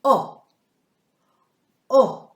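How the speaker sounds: "O" said loud and long; phoneme O. Shown as a demonstration. Teacher modelling the phoneme "O" for children. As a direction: sound out "O" clearly and loudly. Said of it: "It is the phoneme".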